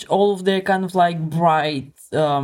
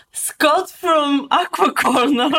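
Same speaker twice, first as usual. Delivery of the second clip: segment announcer; 8 semitones higher, 3 dB louder, much slower than usual. Acted.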